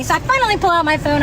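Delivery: ordinary talk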